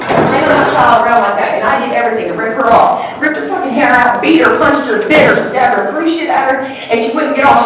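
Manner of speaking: real agitation, high and loud; distressed outburst. Real, not acted. Witness reliving the fight to save her child, distraught. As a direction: pour it out loudly, one blow after another.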